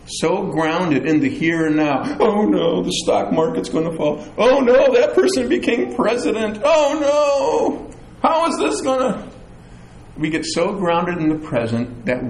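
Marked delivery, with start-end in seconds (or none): 2.2-4.2 higher-pitched fretful wail; mock panic. Acting Christians fretting over present events. 4.4-9.3 loud, high-pitched alarm, hammed-up; mock panic. Acting Christians panicking over present events.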